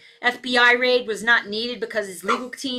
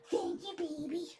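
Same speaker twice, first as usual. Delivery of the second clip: cooing thanks; 5 semitones higher, 16 dB quieter than usual. Real, not acted. Thanking the dog.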